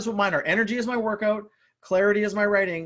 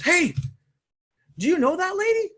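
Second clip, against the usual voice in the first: higher, louder eager question; curious friend; 12 semitones higher, 6 dB louder than usual. Retold or imitated. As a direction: call out to her friend, curious and keen. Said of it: "sister's friends goes".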